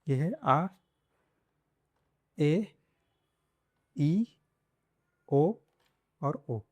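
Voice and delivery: आ, ए, ई, ओ each held apart with pauses; vowel demo. Shown as a demonstration. Teacher to Hindi learners, modelling Spanish vowels. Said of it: "कैसे इसको pronounce करते हैं".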